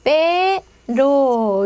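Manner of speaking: 빼로 slowly, with one light tap for r; single tapped r. Shown as a demonstration. Teacher modelling single r, 빼로 slow with a light tap. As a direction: draw out 빼로, tongue flicking once on the ㄹ. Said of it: "이렇게 됩니다".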